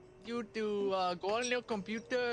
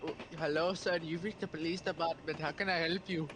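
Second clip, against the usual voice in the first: mock phone operator; 5 semitones lower than usual. Mock police call operator.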